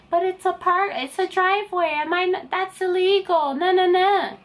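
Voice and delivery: high, singsong mock objection; mock protest. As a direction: whine a protest in a put-on high voice. Mock objection imagined in her head.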